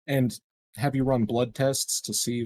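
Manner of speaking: ordinary talk, nothing marked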